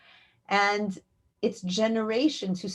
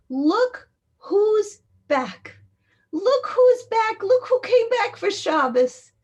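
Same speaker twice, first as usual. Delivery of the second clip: high, loud, slow cry of welcome; jubilant proclamation; 13 semitones higher, 8 dB louder, slower than usual. Retold or imitated. Angels celebrate the family's return to Shabbos, jubilant shout. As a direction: call it out as rejoicing angels.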